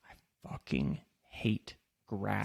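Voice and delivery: slow, soft whisper of disgust; whispered rant. Real, not acted. Host venting about his lawn in a slow whisper. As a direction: hiss the hate under the breath, slowly.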